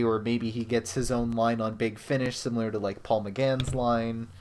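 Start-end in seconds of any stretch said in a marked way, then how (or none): none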